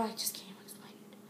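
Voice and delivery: breathy, defeated whisper; hushed, giving up on explaining; exasperated whisper. Real, not acted. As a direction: give up and whisper it. Exasperated over annoying girls' poses.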